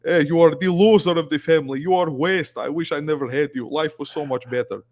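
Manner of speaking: put-on loud, high scolding dad in an Albanian accent; disappointed Albanian father yelling at his son. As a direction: berate your son in a heavy Albanian accent. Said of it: "I have an Albanian dad voice".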